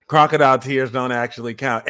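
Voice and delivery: ordinary delivery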